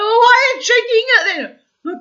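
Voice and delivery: squealing, disbelieving question; laughing incredulous. Real, not acted.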